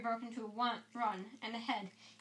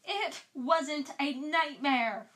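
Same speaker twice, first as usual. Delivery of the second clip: loud, slow, drawn-out yell; 4 semitones higher, 7 dB louder, much slower than usual. Acted. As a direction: shout it back, insulted. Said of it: "Ron yelled".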